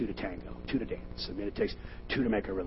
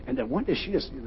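ordinary talk, nothing marked

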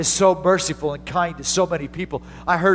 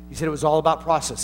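ordinary talk

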